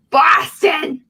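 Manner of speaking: loud, hammed-up Boston accent; aggressive Boston accent. Co-host showing the drunken accent, aggressive Boston. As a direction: shout it in a mock Boston accent. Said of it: "even more aggressive accent it is".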